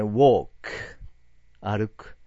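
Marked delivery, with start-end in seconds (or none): 0.0-0.5 "walk" with a long open vowel, said loud; "walk" rounded "ウォーク"; walkの発音. Shown as a demonstration. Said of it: "よく間違うのはね". (「walk」は口を丸めて「ウォーク」)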